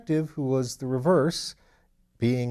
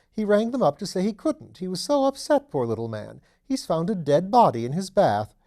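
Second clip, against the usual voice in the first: higher, louder duchess relishing the scandal; mother telling her son the news, a higher, louder duchess; shocked gossiping duchess; 7 semitones higher, 6 dB louder than usual. Acted. Voiced Dowager Duchess.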